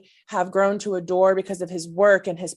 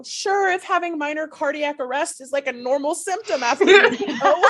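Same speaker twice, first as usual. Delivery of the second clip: laughing joke; 9 semitones higher, 4 dB louder than usual. Retold or imitated. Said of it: "And I go".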